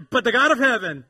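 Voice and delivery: loud, high congregation rally cry; emphatic preacher's call; really meaning it. Shown as a demonstration. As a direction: proclaim it with full conviction. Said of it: "like we really mean it".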